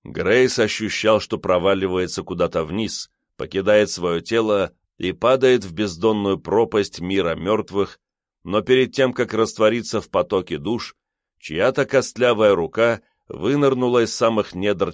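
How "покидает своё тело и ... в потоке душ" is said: usual speaking voice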